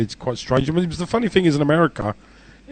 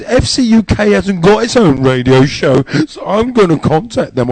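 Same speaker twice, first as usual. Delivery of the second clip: mock complainer; 5 semitones higher, 4 dB louder than usual. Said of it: "he goes".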